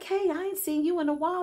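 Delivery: a hint of a cheery shop clerk; store clerk greeting. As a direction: lightly voice the friendly cashier. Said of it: "she like".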